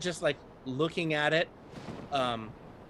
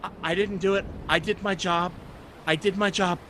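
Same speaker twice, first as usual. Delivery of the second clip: put-on frightened child; 6 semitones higher, 6 dB louder than usual. Scared child NPC hiding.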